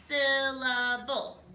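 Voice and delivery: "Syllable" stretched slowly into three loud beats. Shown as a demonstration. Teacher showing a puppet face to count, "Syllable" in three slow beats. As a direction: draw out each part of "Syllable", puppet-mouth style. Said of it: "you could do a puppet face".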